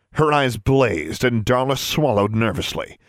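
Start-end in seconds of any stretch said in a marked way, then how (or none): none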